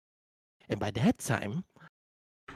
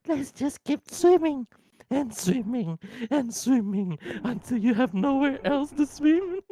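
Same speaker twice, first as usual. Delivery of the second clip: high cheerful creature; 10 semitones higher, 3 dB louder than usual. Acted. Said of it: "I'm going to say".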